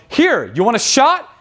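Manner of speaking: high, drawn-out party offer; put-on pushy host; acted brash offer. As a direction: offer it high and slow, like a dare. Acting out offering the shot.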